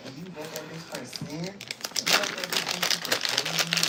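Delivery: loud, rising furious rant at him; angry outburst. Retold or imitated. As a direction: yell it at the cheater. Angry outburst at cheating boyfriend.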